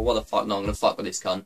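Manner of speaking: quiet, scheming prankster; low, mischievous mutter; sly hacker voice. Retold or imitated. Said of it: "they just thought".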